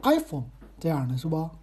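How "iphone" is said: "iPhone" with the weight on the "I"; stress on first syllable. Shown as a demonstration. Host showing listeners the corrected word, front-stressed. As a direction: punch the "I" and let "Phone" fall in "iPhone". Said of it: "那个重音在前面是I啊".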